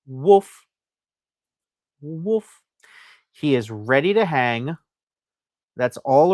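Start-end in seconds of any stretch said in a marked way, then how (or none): 0.1-0.7 a big, loud playful woof for the toy dog; hammed-up woof, louder; loud dog bark. Voicing the cast iron dog. 2.0-2.6 gentle mock woof. Voicing the cast iron dog.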